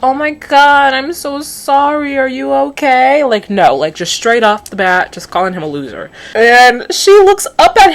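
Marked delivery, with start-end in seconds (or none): 0.0-3.2 higher, slow sugary concern; lightly acted polite girl; mock sweet apology. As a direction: sweetly fuss over the boy. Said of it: "Whatever happened to".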